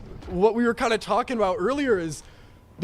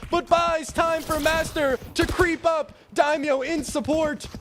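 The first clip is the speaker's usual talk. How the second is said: higher excited call; esports caster; 7 semitones higher than usual. Real, not acted. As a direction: keep the hype going. Hyped play-by-play call.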